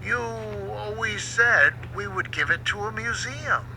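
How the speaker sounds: soft, puzzled question; confused. A narrator's reading. Boat confused by his angry sailor, soft and puzzled. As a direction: gently remind him, bewildered. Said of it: "Skiff was confused".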